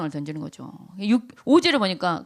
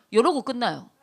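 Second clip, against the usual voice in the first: usual speaking voice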